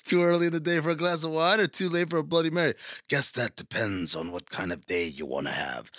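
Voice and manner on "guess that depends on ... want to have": low, soft mock seductive murmur; sultry woman imitation. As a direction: purr it like a flirty stranger at a bar. Voicing the flirty woman.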